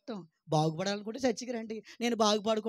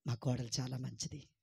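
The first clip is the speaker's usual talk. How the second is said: lower, softer model line; soft, lower demo answer; 6 semitones lower, 7 dB quieter than usual. Shown as a demonstration. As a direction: say it gently as the right answer. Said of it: "నువ్వేమనాలి?".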